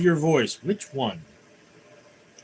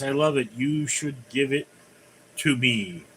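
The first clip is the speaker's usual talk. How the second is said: ordinary talk, nothing marked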